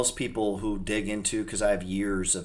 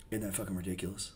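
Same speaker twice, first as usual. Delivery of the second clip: normal talking voice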